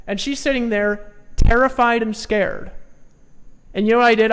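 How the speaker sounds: ordinary delivery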